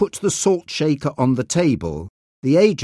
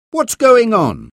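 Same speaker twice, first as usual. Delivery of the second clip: sharp, angry, louder demand; angrily; 10 semitones higher, 7 dB louder than usual. A narrator's reading. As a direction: bark it out much higher and louder. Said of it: "he asked angrily".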